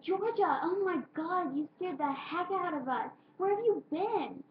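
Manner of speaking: high, loud relieved shout; relieved yell. Retold or imitated. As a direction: shout at her friend, scared and relieved. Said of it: "yanked it off my mouth and said".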